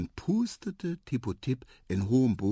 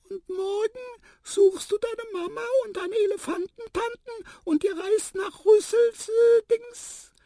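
very high, lively squeak; wide-awake mouse; 6 dB louder than usual. A narrator's reading. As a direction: chirp it loudly, full of beans. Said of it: "fragte Tiputip sehr munter". (putzmunter piepsende Maus)

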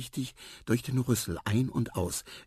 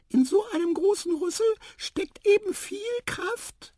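very high, louder tiny voice; squeaky mouse; 5 dB louder than usual. A narrator's reading. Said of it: "piepste die Maus". (hohe, piepsende Maus)

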